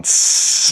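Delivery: tongue hiss like a snake; short hissing breath. Shown as a demonstration. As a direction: hiss through the teeth on the out-breath. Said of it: "the snake breathing".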